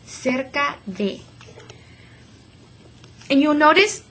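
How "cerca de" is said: soft, slow "Cerca de" for the class to echo; quiet slow repeat. Shown as a demonstration. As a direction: repeat "Cerca de" gently and slowly. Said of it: "repeat after me".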